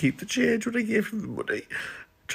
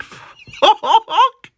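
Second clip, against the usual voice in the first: laughing; 6 dB louder than usual. Retold or imitated. Retelling staring at the fork.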